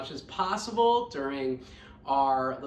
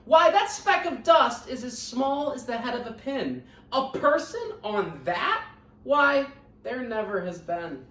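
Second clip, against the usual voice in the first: higher, louder scornful kangaroo voice; sour kangaroo; 6 semitones higher, 4 dB louder than usual. Acted. Sour kangaroo mocking Horton, higher and scornful. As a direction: sneer at the idea with disdain.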